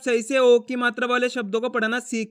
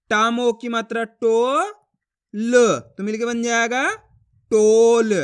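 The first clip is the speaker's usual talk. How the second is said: slow demonstration, long rising टो, then a loud drawn-out टोल; emphatic stretched syllables, pauses between; letter-by-letter sounding out; 5 dB louder, slower than usual. Shown as a demonstration. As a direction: model each sound for pupils to copy, landing firmly on टोल. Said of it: "मैं किस तरीके से एक ही अक्षर का उच्चारण".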